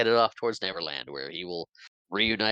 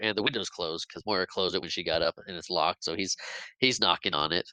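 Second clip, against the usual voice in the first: normal talking voice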